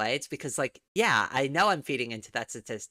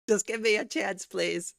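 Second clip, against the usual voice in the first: begging; 7 semitones higher than usual. Pretend. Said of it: "There's my subscription beg".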